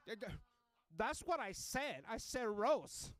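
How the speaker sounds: put-on high, loud, drawn-out accented shout; exasperated lady passenger. Misheard passenger correcting driver, shouted exasperation. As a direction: hammer each word in exasperation.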